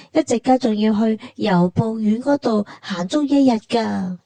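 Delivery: ordinary talk, nothing marked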